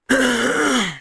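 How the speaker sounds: long, high-pitched throat clear; throat-clearing cough. Real, not acted. Clearing the throat before reading on, drawn-out cough. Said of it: "That cough".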